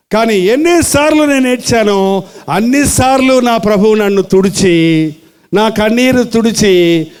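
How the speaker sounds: fervent testimony. Acted. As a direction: proclaim it with conviction. Testimony preached with force.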